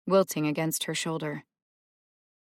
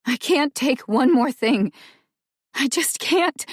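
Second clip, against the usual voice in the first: loud, higher, breaking cry; anguished cry; 8 semitones higher, 8 dB louder than usual. Acted. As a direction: sob it out, at the end of her rope. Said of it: "let out a hard cry".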